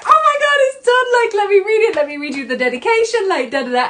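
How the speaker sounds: very high, loud, gushing excitement; excited retelling. Retold or imitated. To her mum and dad about her finished book, gushing high and loud. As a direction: squeal it out like you just finished a book. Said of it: "I'm like".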